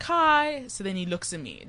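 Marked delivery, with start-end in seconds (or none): 0.0-0.6 high, eager hello to a stranger; eager greeting. Retold or imitated. Student greeting the guy next to her in lecture, eagerly. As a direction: pitch it up, keen to make a friend. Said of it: "like, so unimpressed".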